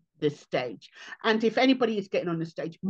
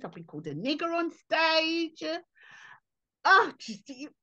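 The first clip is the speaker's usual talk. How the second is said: ordinary talk, nothing marked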